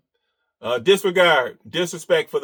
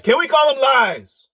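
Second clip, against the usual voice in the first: pitched up and insistent; Can we call them lies pushed higher, stress on we; higher, pressing repeat; 9 semitones higher, 4 dB louder than usual. Shown as a demonstration. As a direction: push the question up in pitch to needle him. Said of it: "first take plain".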